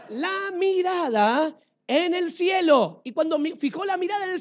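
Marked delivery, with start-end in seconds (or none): 0.1-3.0 awed gaze heavenward. A narrator's reading. Preacher slows for the heavenward gaze.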